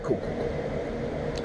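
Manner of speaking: slow whispered cool cool; slow, voiceless whisper. Retold or imitated. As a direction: breathe it out barely voiced. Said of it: "So I was like".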